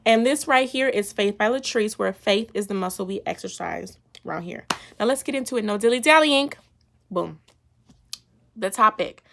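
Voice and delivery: ordinary delivery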